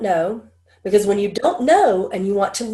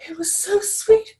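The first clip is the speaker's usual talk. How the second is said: moved stylist; 12 semitones higher than usual. Retold or imitated. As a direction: lift way up, as someone overwhelmed. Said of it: "She was like".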